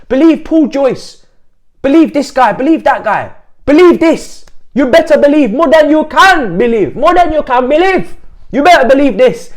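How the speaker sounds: high, loud imitation of cocksure fans; doubling down. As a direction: hammer each line as an insistent retort. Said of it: "They were telling us, double down in".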